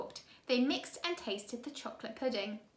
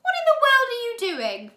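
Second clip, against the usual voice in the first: loud, much higher eerie woman's voice; 12 semitones higher, 11 dB louder than usual. A narrator's reading.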